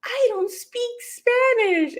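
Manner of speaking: high, slow imitation of a sweet old man; sweet old man on the phone, gently apologetic. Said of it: "he sounded like the sweetest old man".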